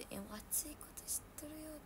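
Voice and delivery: soft unvoiced whisper; whispered aside. Real, not acted. To herself about the staff, whispered. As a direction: breathe it under the breath.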